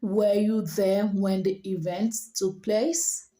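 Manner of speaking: slow, even question lifting on "place"; rising intonation. Shown as a demonstration. Teacher models a question for learners, rising on "place". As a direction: keep it level until "place", then go up. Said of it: "So it's going up".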